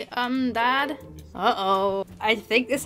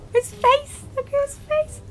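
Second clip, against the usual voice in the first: shocked; 14 semitones higher than usual. Real, not acted. Shocked by Dad's creepy face.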